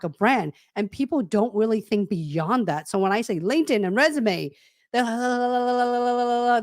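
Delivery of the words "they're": long rolling "luh-luh-luh" babble; mock nervous babble. Coach imitating job seekers who hear "LinkedIn and resume", nervous babbling. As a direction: imitate someone flustered, tongue running on without words. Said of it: "they're so nervous and they just".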